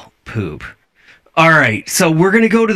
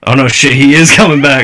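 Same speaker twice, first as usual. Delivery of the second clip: much louder, laughing alarm; alarmed outburst; 7 dB louder, faster than usual. Real, not acted. Viewer sees he is coming back, loudly alarmed. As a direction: call it out loud with a laugh. Realises the character is returning.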